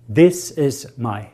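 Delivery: this is my, said slowly; slow declaration. Shown as a demonstration. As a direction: say it slow and firm, for the flock to repeat. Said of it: "say that with me".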